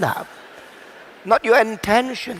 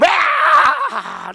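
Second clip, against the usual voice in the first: shocked cry; 4 dB louder than usual. Retold or imitated. Child gets electric shock.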